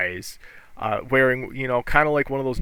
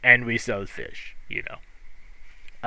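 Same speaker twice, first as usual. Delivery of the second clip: put-on gruff old fisherman; 5 semitones higher, 9 dB louder, slower than usual. GM as fisherman, proud.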